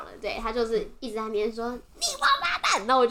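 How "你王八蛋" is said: very high-pitched imitated insult; mock furious curse. Imitating the old man's repeated cursing, high mock rage.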